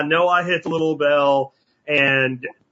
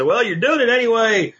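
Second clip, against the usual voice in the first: higher-pitched imitation of onlookers; onlookers' objection; 9 semitones higher than usual. Onlookers brushing off creators' filming work, imitated in a higher voice. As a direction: lift the pitch to mimic a casual objection. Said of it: "they look at what we do ... and they say".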